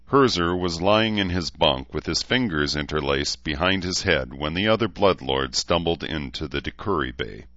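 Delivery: plain reading voice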